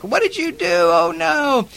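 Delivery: high-pitched, alarmed cry of dismay; shocked dismay. Retold or imitated. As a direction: squeal it like the homeowner just saw the mess. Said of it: "she's basically like".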